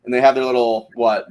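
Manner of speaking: ordinary talk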